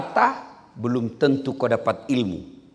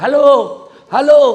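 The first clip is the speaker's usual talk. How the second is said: much higher, much louder hello; retold shout; 13 semitones higher, 14 dB louder, slower than usual. Old man shouts into a phone held upside down. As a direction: bellow it into the receiver. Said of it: "Teriaklah dia".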